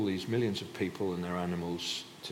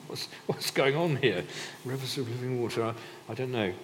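puzzled, higher-pitched listener; mock bewilderment; 4 semitones higher than usual. Uneasy listener reacting to talk of living water, mock bewildered. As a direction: act out someone baffled by what they hear.

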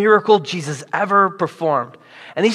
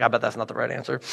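hushed aside; 6 semitones lower, 9 dB quieter, much faster than usual. Retold or imitated. Disciples whispering to each other.

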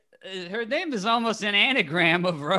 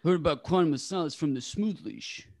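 Sly impression; 3 semitones lower, 4 dB quieter, faster than usual. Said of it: "little Sly impression".

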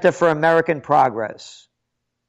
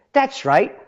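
ordinary talk

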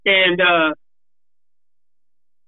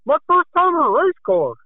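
much higher, mocking naive driver; mocking rookie voice; 9 semitones higher than usual. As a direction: sneer it like a wide-eyed rookie. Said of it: "Yeah, you know".

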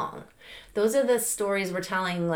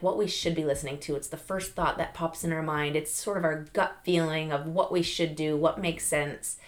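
ordinary talk, nothing marked

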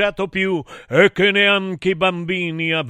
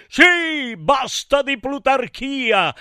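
high, theatrical mock shout; high-pitched rallying cry; crowd's outcry; 7 semitones higher, slower than usual. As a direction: call it out high like a chorus of protest. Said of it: "mi hanno gridato".

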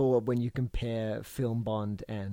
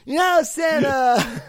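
mock Hitler voice; 7 semitones higher, 7 dB louder than usual. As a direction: sneer at Santa as a cartoon villain. Acts out Hitler talking to Santa.

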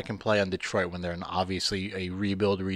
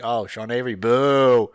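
crowd booing; 8 dB louder than usual. Retold or imitated. Host re-enacting his booing of Avery at the game, loud jeering. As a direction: jeer like a fan in the stands. Imitating fans booing a player.

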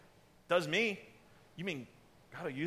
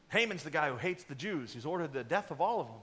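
usual speaking voice

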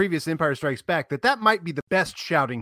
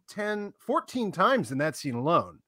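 usual speaking voice

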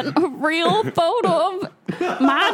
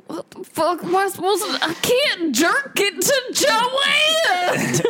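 put-on hillbilly accent; 4 semitones higher than usual. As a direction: shout it like an outraged hillbilly. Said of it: "Your hillbilly accent is great".

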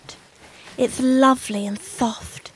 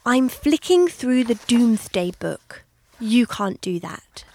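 ordinary talk

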